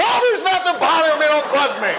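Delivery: high, loud, fast, irritated; husband snapping at his worried wife; irritated snap. Retold or imitated. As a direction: snap back annoyed.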